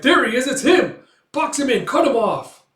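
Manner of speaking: high, loud pursuit calls; acted battle cries to the men; urgent shouted orders. Pursuers spot their man, urgent shouts.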